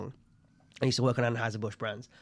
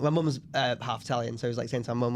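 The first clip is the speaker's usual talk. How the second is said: ordinary talk, nothing marked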